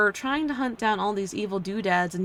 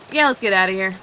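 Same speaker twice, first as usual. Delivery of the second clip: ordinary talk, nothing marked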